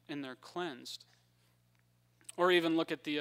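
usual speaking voice

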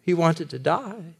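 ordinary talk

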